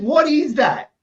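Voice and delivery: high-pitched, incredulous demand; indignant retold question. Customer to server about a milky macchiato, indignant. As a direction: challenge the server in disbelief.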